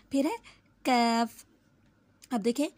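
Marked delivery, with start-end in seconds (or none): 0.8-1.4 कैफ said light and thin; light letter demo. Shown as a demonstration. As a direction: clip कैफ forward in the mouth. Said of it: "ये बारीक कैफ है".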